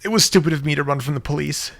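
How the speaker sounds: tearful apology. Acted. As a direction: apologise on the edge of tears. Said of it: "with a crackling voice".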